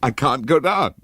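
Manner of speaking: high, loud, panicked scream. A narrator's reading. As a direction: cry it out in terror from the stairs. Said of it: "she screamed".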